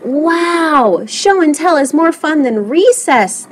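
high-pitched kids' cheer, lightly voiced; mock yell with swooping pitch; excited yell. A narrator's reading. Classmates enjoy show and tell, yelling in mild excitement. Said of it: "they yelled".

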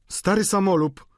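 high, loud indignant landlady; gniewny okrzyk. A narrator's reading. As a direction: call him out indignantly, pitched high. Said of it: "zawołała pani Wauquez". (wysoki, oburzony głos gospodyni)

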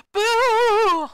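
loud, high spooky Boo; jump-scare shout of Boo; ghostly shout. A narrator's reading. Ghost scaring the zombie, a loud high shout. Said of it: "the ghost who shouted out".

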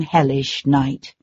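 usual speaking voice

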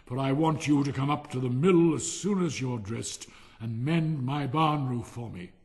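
loud, self-assured miller; hearty, bossy miller. Acted. Miller sends Hans to mend the barn roof, loud and bossy. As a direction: give orders with booming bonhomie.

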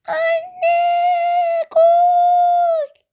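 put-on a girl shrieking for her mother; terrified scream.

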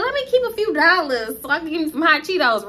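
a hint of a ratchet joking voice; real ratchet. Storyteller jokes to coworkers about keeping cash, lightly ratchet. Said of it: "real ratchet".

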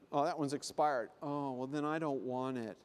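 mildly disappointed customer voice; fussy coupon customer. Acted. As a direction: say it low and unhurried. Voices slow coupon customer at checkout.

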